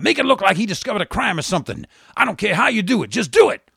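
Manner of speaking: high, loud angry orders, fast in places; angry tirade. Acted. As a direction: berate him without pause. First Lady berates personnel director.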